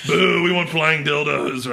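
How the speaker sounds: booing heckle. Retold or imitated. Drunk crowd booing the performers, laughing boo. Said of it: "And I'm like".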